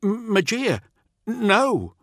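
high, frightened stammer; Teth pleading. Acted. Teth pleading as the tracker turns on him. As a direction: beg in panic. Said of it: "Teth begins to say".

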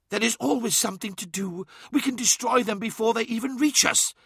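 high, urgent, pressing; urgent hiss. A narrator's reading. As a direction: push the plan at Magnus. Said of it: "hissed Ammon".

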